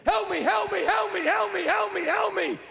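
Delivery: high, rapid repeated yelps; mock-frantic dog at the window; pleading dog. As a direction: bark the plea over and over. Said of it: "You get a dog's attention".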